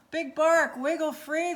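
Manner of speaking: loud high call up to the sky; a prairie dog shouting up at Big Bark in the eagle's talons; urgent shout. Acted. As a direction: yell up at him to wriggle free. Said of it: "the prairie dog shouted".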